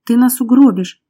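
loud, alarmed warning. A narrator's reading. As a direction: cry out in fear of a crash. Frightened passenger in speeding car.

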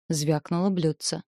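usual speaking voice

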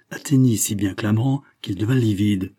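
ordinary delivery

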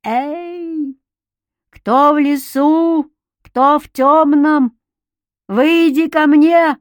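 high, loud, slow calling into the forest; far away shout. A narrator's reading. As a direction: call out slowly into the dark woods. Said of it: "встала в проёме и закричала".

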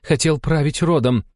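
high, tense woman's voice, climbing; seething accusation. A narrator's reading. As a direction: press each charge with contempt. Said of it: "процедила Алла". (процеживая обвинения)

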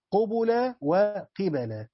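قبل said first as qubula, then وقبل as qibala, slowly; two readings qubula and qibala. Shown as a demonstration. Teacher to students, slowly sounding out both readings. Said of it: "آه، قراءتان".

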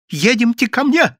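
high, loud urgent summons; urging. A narrator's reading. Yevsey urging his friend to come and talk, urgent. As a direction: hurry them along insistently. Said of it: "торопил Евсей".

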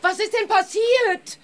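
loud, high, over a crowd; calling out. Acted. Reporter calls out to the angry villagers, loud. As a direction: shout to calm the mob.

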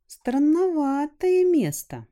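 put-on high, slow uneasy girl; wary girl voice. A narrator's reading. Rusya voice, slow.

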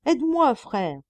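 high, loud plea; calling for help. A narrator's reading. Drowning monk begs his brother, loud high plea. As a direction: cry out as the water rises. Water reaching his mouth.

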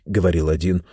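ordinary delivery

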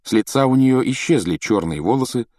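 plain reading voice

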